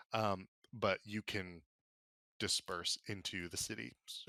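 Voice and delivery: ordinary delivery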